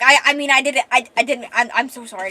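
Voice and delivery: loud, stammering backpedal; flustered apology. Acted. He apologizes to her for blurting out a confession, flustered. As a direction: stumble over a hurried, panicky apology.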